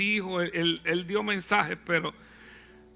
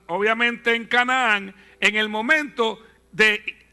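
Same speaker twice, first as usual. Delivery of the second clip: usual speaking voice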